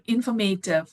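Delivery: "informative" as in-for-MAY-tive; Indian pronunciation. Shown as a demonstration. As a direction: say "informative" with a long "may" syllable. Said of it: "Yeah, we in India".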